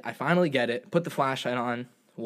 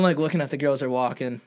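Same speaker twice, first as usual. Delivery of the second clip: ordinary delivery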